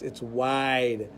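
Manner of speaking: ordinary talk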